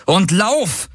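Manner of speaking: high urgent cry to run; urgent shout. Acted. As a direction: yell at her to run now. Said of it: "rief Galen".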